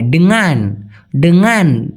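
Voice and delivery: ডেঙ্গান with a nasal ng like ব্যাং; ডেঙ্গান with ng ringing as in ব্যাং; correct pronunciation. Shown as a demonstration. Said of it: "উময় আকার দিলে সবচেয়ে ভালো হয়".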